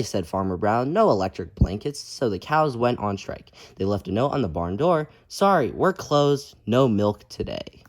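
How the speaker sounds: lively, loud storybook reading; reading to children. A narrator's reading. Teacher reading a picture book aloud to kids, lively. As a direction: read it out big for the kids.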